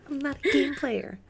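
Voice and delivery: put-on high cutesy girl voice; imitating commercial's Courtney. Host replaying girl from dating ad, high cutesy voice. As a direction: mimic the ad girl, slowing into singsong. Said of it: "She's like".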